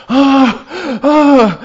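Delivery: loud, high anguished cries; relieved wail. Acted. Castaway on the beach crying out loud in relief. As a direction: cry out on reaching land.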